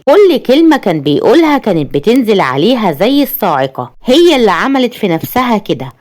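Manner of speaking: usual speaking voice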